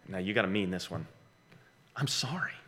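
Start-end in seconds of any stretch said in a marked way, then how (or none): 1.9-2.5 soft apology. Shown as a demonstration.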